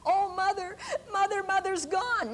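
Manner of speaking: a mock grieving wail for the mother; retold crying. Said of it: "she's crying".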